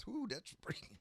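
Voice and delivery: breathless after coughing; out of breath, gasping lightly. Real, not acted. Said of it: "Let me catch my breath".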